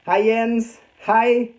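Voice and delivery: ordinary talk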